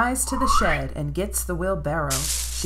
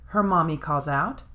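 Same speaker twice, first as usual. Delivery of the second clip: ordinary delivery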